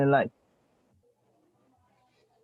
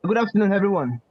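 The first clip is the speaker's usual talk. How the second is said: louder, projecting to be heard; speaking louder; 4 semitones higher, 4 dB louder than usual. Real, not acted. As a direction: raise your voice for the room. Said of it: "can you speak louder".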